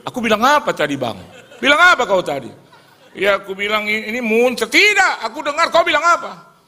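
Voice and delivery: high loud argument with laughter; laughing mock quarrel. Two Batak men argue over the threat, loud high. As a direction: play both men bickering.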